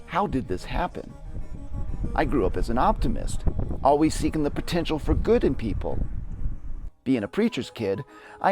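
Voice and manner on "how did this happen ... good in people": usual speaking voice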